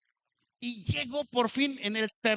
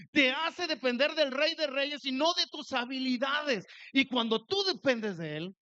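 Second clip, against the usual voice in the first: preaching; 4 semitones higher than usual. Acted. Preaching to the congregation.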